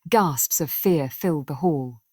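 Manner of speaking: ordinary delivery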